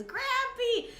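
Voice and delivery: high yelled Grampy; Frankie shout. Retold or imitated. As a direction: shriek it out high and loud. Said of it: "Frankie thing where he goes".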